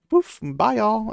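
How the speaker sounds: high, loud, flippant goodbye; mock breezy farewell. Novice quitting the tower on a whim, playful flippant farewell. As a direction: toss out a jaunty pretend exit.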